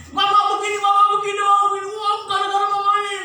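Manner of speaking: high, loud, half-sung shouting complaint; husband yelling back. Retold or imitated. Husband yelling complaints back at his wife, loud and high. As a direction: bark the complaints right back at her. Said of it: "sekarang yang pria, yang suami".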